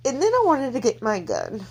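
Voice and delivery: high, choked-up outburst; tearful anger. Real, not acted. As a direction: push it out through tears, pitched high. Tearful anger about the abuser.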